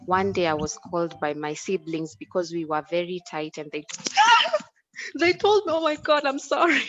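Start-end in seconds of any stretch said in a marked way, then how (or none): 4.5-6.8 high, giggling through the words; laughing. Real, not acted. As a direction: laugh helplessly while apologising. Bursts out laughing mid-story.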